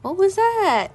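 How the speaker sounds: high, loud incredulous question; mock bafflement. Host questioning her own skit intro, mock baffled. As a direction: pitch leaps up in exaggerated disbelief.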